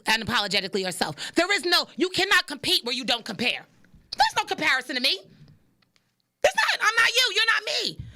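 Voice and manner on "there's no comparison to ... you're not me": pitched way up, insisting; high emphatic insistence. Real, not acted. Telling viewers nobody compares to her, high and emphatic. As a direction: push it up high and firm.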